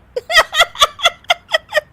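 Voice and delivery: very high giggling fit; high giggle. Acted. Daughter-in-law laughing at guest's stained kurta, giggling. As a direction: burst into giggles.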